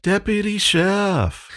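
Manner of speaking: very high, drawn-out echo; Ronaldo. Acted. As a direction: call it back slowly in a high voice. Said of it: "you hear Ronaldo in the back".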